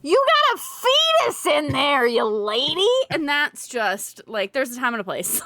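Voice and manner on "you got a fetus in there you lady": disgusted mock voice. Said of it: "He was literally like".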